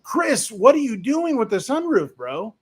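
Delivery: can't believe what he's reading; incredulous. Real, not acted. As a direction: streamer reacting to a viewer's sunroof that broke again. Reacting to viewer's broken sunroof.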